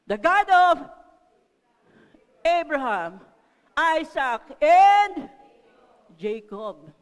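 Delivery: very loud, solemn declaration with pauses; booming proclamation. Acted. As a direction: proclaim each name at full force. Proclaims Israelites' name for God.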